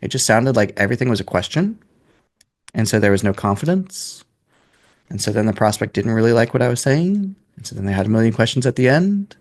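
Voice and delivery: every sentence ending on a questioning rise; unsure upspeak, statements turned into questions. Shown as a demonstration. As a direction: lift the end of each phrase like a question. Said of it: "ended all of my sentences with upspeak".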